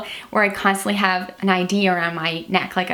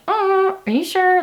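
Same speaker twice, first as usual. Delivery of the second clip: high, timid mock reluctance; hesitant doubter; 12 semitones higher, slower than usual. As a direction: play a nervous nobody questioning orders, pitched way up. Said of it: "And he was like".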